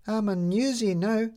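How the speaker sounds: put-on the little girl standing her ground; defiant. A narrator's reading. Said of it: "said Midge defiantly".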